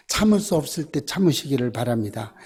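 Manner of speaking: ordinary talk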